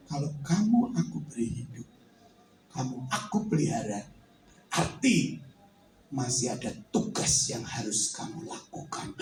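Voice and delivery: the Lord speaking low and soft to Vincent; voice of God. Acted. God gives a task.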